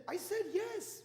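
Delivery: mock-indignant, high-pitched comeback; exasperated retort. Wife answering husband about the camera, mock exasperated retort.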